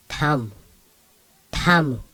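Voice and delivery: tam with a puff of air after T; aspirated T. Shown as a demonstration. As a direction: say tam opening on a breathy T-H. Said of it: "aspiration sounds".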